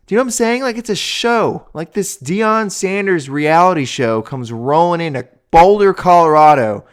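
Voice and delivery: high and loud, ranting; riled up. Real, not acted. Host ranting at Deion's show, high and loud. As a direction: let the outrage build, loud. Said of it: "it gets me so riled up".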